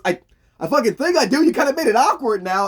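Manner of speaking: high, loud, embarrassed complaint; flustered outburst. Retold or imitated. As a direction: squawk it out, mock flustered. Flustered reply in acted argument.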